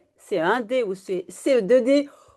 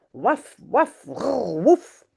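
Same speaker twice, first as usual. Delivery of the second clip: loud barking; much slower than usual. Acted. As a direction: bark hard at the cat. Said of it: "hij blaft zo hard". (Taki aboie fort après le chat)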